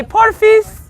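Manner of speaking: porfis squeaked up high and sweet; high playful porfis; cute girly porfis. Shown as a demonstration. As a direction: chirp porfis like a cute girl. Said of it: "If you're a girl and you wanna be cute".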